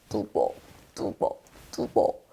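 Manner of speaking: low, hushed step-by-step words; trudging footsteps. Acted. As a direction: trudge softly on each word. Acting out weary desert footsteps. (낮게 속삭이듯 뚜벅뚜벅)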